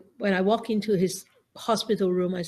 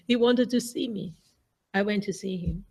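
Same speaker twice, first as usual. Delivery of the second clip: ordinary delivery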